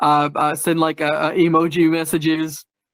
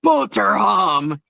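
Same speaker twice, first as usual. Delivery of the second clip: slow, gleeful mock yell of boterham; playful yelling; 3 semitones higher, much slower than usual. Shown as a demonstration. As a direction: relish BOTERHAM, stretching each syllable. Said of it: "I've been yelling that".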